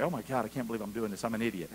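muttered to self. Retold or imitated. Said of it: "It's like".

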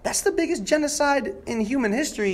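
a retold gasp of disbelief; stunned realization. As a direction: sound floored by what you just learned. Retells his own shocked realization.

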